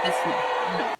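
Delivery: ordinary delivery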